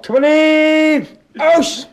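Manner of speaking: high, loud calling out; shouting call. Retold or imitated. Woman calling out across the loft, high loud shout. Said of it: "hørte vi pludselig hende råbe".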